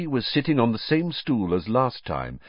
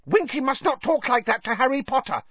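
high-pitched, squeaky rebuke; cross house elf, very high; angry elf. A narrator's reading. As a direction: scold in an indignant little elf voice. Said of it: "said Dobby angrily".

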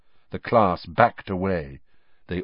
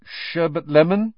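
tentative guess; 8 semitones higher, 4 dB louder than usual. Acted.

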